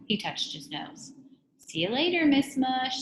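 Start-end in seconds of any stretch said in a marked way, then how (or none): 1.6-3.0 high schoolboy farewell; Eric Evans. A narrator's reading. Teacher fakes a boy's goodbye to visitor, high boyish call. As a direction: call goodbye as a boy, pitched up. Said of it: "said the voice of Eric Evans".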